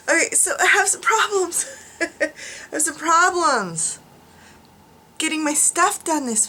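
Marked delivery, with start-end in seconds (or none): 0.1-4.0 laughing. Real, not acted. Laughing at herself.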